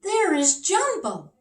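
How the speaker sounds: high, delighted king; king's voice. Acted. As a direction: call it out like a thrilled little king. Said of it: "said the king".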